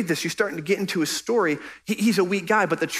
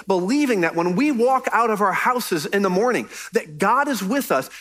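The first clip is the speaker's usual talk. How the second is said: usual speaking voice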